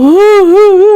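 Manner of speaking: high, loud mock sob; a short wailing sob, retold; imitated sobbing. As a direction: imitate a man crying in the shower. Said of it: "울고 있는 걸 들은 거야".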